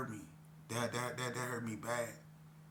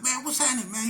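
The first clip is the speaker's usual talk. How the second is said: much higher, louder happy hello; excited greeting; 11 semitones higher, 7 dB louder, faster than usual. Retold or imitated. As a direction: greet an old friend with a burst of joy. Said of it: "I'm like".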